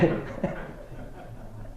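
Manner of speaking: soft laugh after the punchline; real laugh. Real, not acted. Storyteller laughing at his own joke's punchline, amused. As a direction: laugh quietly at your own joke.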